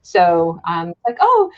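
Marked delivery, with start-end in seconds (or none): 1.2-1.5 high, sing-song mock politeness; overly polite Canadian. Joking about Canadians, mock polite Canadian yielding in a high voice. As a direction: ham up a too-polite Canadian insisting you go first. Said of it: "the big joke for everybody in Canada is".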